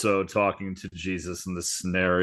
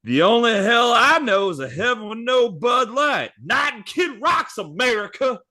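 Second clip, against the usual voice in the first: Bubby's voice; 13 semitones higher, 3 dB louder than usual. Acted. Host voicing Bubby arguing with Jesus, high and brash. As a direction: pitch it way up for Bubby's cheeky reply. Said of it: "And then Bubby goes".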